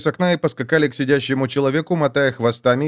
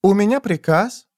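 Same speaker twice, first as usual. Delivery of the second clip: ordinary talk, nothing marked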